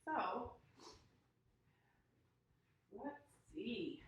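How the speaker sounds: usual speaking voice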